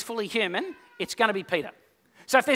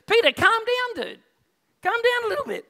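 casual Jesus; 12 semitones higher than usual. Acted. Jesus voiced telling Peter off.

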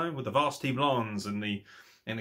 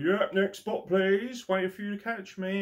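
higher, bored mock wrestler waiting; bored wrestler quote; 8 semitones higher than usual. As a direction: mimic a wrestler just going through the motions. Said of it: "Don't just stand there like".

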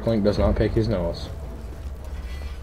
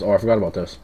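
ordinary delivery

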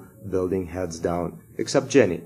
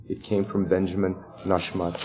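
ordinary delivery

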